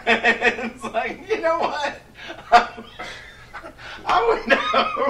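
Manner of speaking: words squeezed out through laughter; laughing so hard the words go high. Real, not acted. Host laughs while acting out the scenario.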